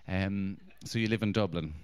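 usual speaking voice

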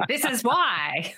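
mock the know-it-all critics, slowly; lightly voiced judgmental onlooker; smug critic. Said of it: "so they can tell you".

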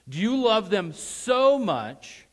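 high, loud, stretched "so"; stress on so. Shown as a demonstration. Preacher challenging listeners about their families, booming drawn-out stress on "so". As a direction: hit "so" hard and hold it long. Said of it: "emphasize so in your mind".

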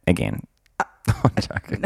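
ordinary talk, nothing marked

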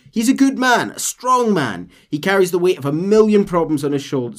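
loud, high proud praise; De Santa defending Allende; admiring captain. Acted. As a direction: praise him with fervour.

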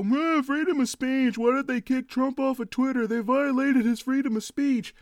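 high, loud whiny mockery; mocking conservative. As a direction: whine it like a sore loser. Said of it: "these people try to go".

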